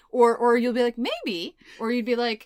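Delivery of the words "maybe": high-pitched, doubtful mock answer; mimicked high maybe. Mimicking a partner dodging plans, high and evasive. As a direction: go high, imitating a reluctant partner. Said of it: "You should hear my tone".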